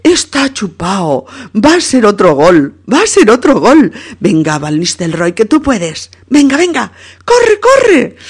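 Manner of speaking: cada vez más aguda y fuerte, animando; de animar a gritar de emoción; hincha emocionada. Acted. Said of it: "Pilar dice". (Pilar anima a su jugador, subiendo hasta gritar)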